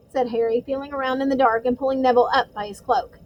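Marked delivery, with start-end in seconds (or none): none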